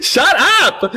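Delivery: high laughing shut up. Real, not acted. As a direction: laugh it off while telling them to hush. Mocking caller, laughing.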